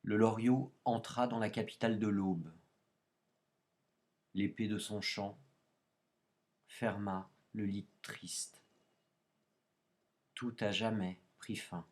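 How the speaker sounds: slow, measured poem recitation; poetry reading. Acted. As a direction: read the poem with long, deliberate pauses. Lit un petit poème. (lecture de poème, lente et posée)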